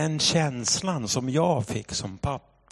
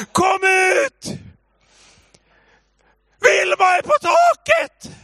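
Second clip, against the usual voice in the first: very high, loud panicked holler; re-enacted scream; 8 dB louder than usual. Husband calls wife out, panicked full-voice scream. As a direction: scream the alarm to the whole street. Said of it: "jag skrek".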